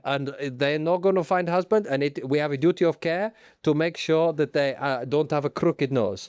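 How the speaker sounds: put-on comic Italian accent; Stefano Domenicali. Boss introducing himself to the public, Italian accent. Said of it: "As Italians".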